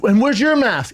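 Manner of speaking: high-pitched mock demand; retold yell. Rider challenges passing bikers, mock yell. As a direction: call it out like a nag. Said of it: "yell at the other guys".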